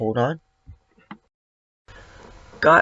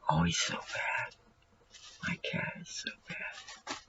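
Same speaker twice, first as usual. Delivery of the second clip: hushed whisper to the viewers; 18 dB quieter than usual. Real, not acted. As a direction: whisper the aside so the cat won't hear. Aside to viewers about cat.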